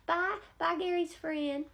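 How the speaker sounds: very high, sugary grandma greeting; high doting old-lady voice; sweet old lady voice. Retold or imitated. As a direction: coo at him like a doting granny teller. Teller voiced in retold story.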